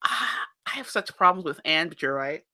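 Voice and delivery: ordinary talk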